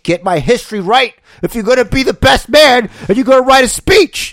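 loud, high mock scolding; mock angry rant. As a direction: yell it in playful outrage. Mock scolding the best man.

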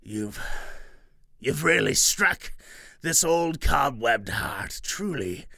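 hushed awed hag; touched witch. Acted.

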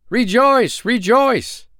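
very high, loud rejoicing; joyful cry. Acted. Three friends tell the man, crying joyfully. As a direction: cry out in joy. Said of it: "cried the three".

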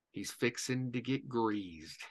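low-key, slow and quiet, drawn out; slow, hushed retold warning; deadpan warning. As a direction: quote the captain slowly and softly, letting it land. Retold captain's ominous answer.

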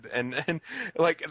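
ordinary talk, nothing marked

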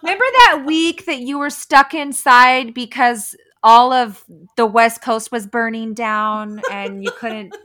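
high, loud, sing-song sarcasm; mock cheerful. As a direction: gush over it with fake nostalgia. Mocking future fond memories.

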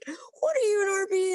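very high-pitched, imitated disbelief; retold amazement. Buddy learns she is an RP now, retold astonished. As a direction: squeal the question like an astonished buddy.

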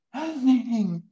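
"fascinating" spoken on a sharp in-breath, pitch high; said on an inhale. Shown as a demonstration. Proposing a new way to say the word, inhaled. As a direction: breathe in while saying "fascinating". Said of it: "say fascinating by speaking in".

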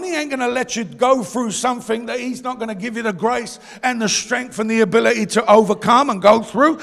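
usual speaking voice

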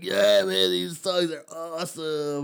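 slow, stretched-out stoner voice; stoned teenager. Retold or imitated. Stoned kid raving about the music, slow hammed-up stoner voice. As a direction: draw it out like you're high. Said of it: "that's what happened when we'd get stoned".

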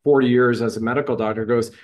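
usual speaking voice

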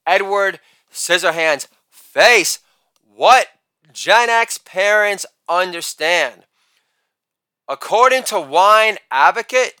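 ordinary talk, nothing marked

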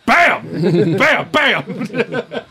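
sharp high-pitched bangs; startling vocal crash effect; imitated banging. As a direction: punch out each bang suddenly. Imitates banging that scared him.

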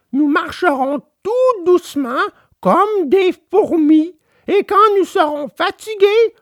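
put-on high, gentle boy puppet; Pinocchio voice. A narrator's reading. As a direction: coax his weak father along kindly. Pinocchio helps his father.

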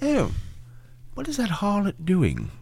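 put-on high, soft scandalised old lady; prudish old lady. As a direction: clutch your pearls and gasp.

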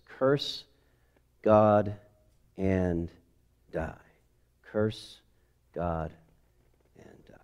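each word set apart, slow and soft; weighty pause-filled delivery; slow dramatic pauses. Acted. Dramatic slow reading of wife's words.

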